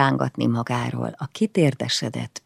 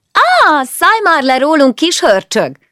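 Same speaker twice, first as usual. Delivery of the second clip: high, loud pretend-cross yell with a smile in it; mock angry shout; 14 semitones higher, 10 dB louder than usual. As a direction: scold him playfully, grinning. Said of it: "kiáltott rá Petire látszólag mérgesen, de a hangja mosolygott". (tettetett mérges kiáltás, mosolygó hanggal)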